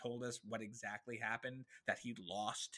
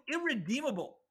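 much higher, louder dramatic verdict; emphatic verdict; 12 semitones higher, 7 dB louder than usual. Real, not acted. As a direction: pronounce it like a final judgment. Topping partner's verdict.

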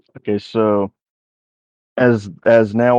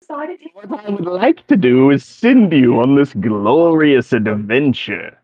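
put-on higher, self-important old wizard; pompous wizard; 5 semitones higher, 4 dB louder than usual. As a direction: announce yourself like a grand wizard. DM voices the wizard.

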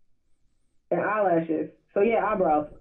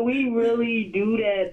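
normal talking voice

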